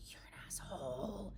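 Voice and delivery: slow, soft whisper; whispered insult. Retold or imitated. Grieving mom says it to herself under her breath, whispered. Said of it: "Now I'm like".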